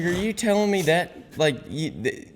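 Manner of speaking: high, halting incredulity; retold disbelief. Buddy trying to grasp the explanation, incredulous. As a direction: imitate a buddy struggling to take it in.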